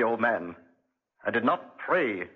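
normal talking voice

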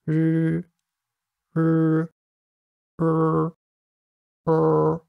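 each うー a held English "rrr", tongue placed slightly differently; four English Rs. Shown as a demonstration. Teacher comparing four R methods for learners, held English R. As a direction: hold うー as an R hum, tongue tip never touching. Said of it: "こんな感じです".

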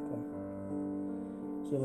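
usual speaking voice

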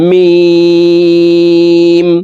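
"মিম" with a very long ee on a high level tone; four-alif stretch. Shown as a demonstration. Teacher demonstrating meem, long drawn-out hold. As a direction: draw out "মিম" as one steady chanted note. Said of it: "মিম হরফকে চার আলিফ টেনে পড়ব".

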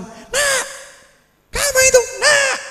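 very high mock screech at a child; mother hollering. Mother scolding her hyperactive child, high mock hollering. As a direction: imitate an exasperated mother yelling. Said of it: "bengak-bengok".

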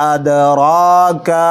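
আদরাকা with a bouncing echo on the d; qalqala on dal. Shown as a demonstration. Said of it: "ডাল কলকলার হরফ". (আদরাকা-তে ডালের কলকলা)

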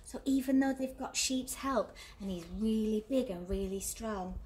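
ordinary delivery, nothing marked

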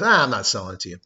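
snatch the deal away with a mean little laugh; cruel taunt. Pretend. Cruel seller takes offer back.

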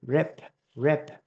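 "Rip" ending with a puffed, aspirated p; teacher demo of the final p in "rip". Shown as a demonstration. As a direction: release a breath of air on the final p of "rip". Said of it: "phía sau này là bật hơi nha các bạn".

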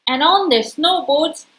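plain reading voice

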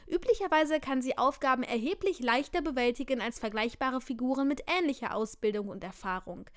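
usual speaking voice

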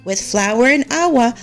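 plain reading voice

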